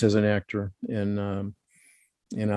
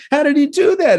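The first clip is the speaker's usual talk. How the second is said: amazed disbelief; 7 dB louder than usual. Retold or imitated. Amazed at actor's hidden accent.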